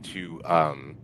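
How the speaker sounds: ordinary talk, nothing marked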